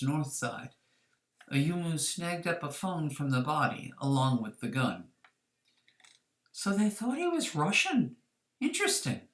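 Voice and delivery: plain reading voice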